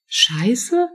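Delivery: Scheiße? thrown back with a sharp high rise; incredulous. Acted. Teen daughter disbelieving her mother's reaction, incredulous high echo.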